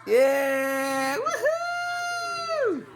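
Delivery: excited high cheers; cheering. Real, not acted. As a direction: whoop with joy. Cheering draft pick.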